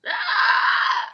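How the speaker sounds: loud high wailing shriek; banshee shriek. Acted. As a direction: let out a ghostly scream.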